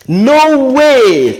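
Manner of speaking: exclaimed no way; mock disbelief. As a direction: act stunned at the claim. Reacting with disbelief.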